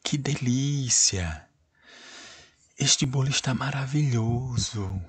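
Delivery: lively, delighted reading; exclamatory example. Shown as a demonstration. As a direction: show the joy of an exclamation. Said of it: "Por exemplo".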